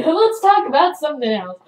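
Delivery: loud, high change of subject; hasty deflection. Retold or imitated. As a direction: blurt it out to deflect attention. Covering embarrassment with friends, acted.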